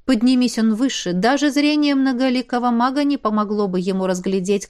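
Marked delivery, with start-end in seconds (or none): none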